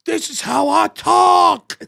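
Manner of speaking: loud, high, slow mock bellow; hammed-up shout, slow and high; imitated comic yelling. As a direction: stretch it out as a hollered comeback. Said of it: "Why are you yelling at me?".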